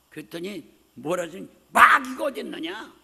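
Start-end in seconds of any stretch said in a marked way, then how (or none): 1.7-2.9 loud scoffing shout; unbelieving father shouting at his son; angry father's shout. Retold or imitated. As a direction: shout him down in disbelief. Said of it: "막 그러세요".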